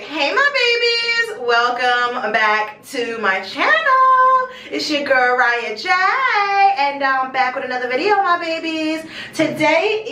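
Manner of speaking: slow, stretched-out vlog intro; drawn-out channel greeting. Real, not acted. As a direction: draw out the greeting to the viewers. Channel intro, first full take.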